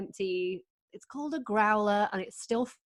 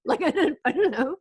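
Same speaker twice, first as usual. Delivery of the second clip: normal talking voice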